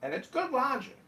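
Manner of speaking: put-on Indian accent. Mock Indian accent tried on the room. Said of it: "new, uh, accent for you?".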